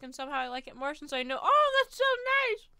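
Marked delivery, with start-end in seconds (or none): 1.4-2.7 high, loud delighted gush; excited. Real, not acted. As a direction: squeal with real joy. Delighted by a kind chat message.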